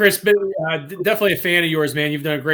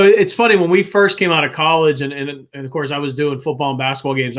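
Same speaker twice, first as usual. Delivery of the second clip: ordinary talk, nothing marked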